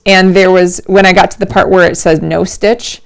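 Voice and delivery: ordinary talk, nothing marked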